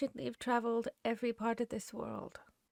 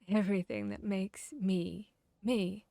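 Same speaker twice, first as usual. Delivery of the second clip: ordinary delivery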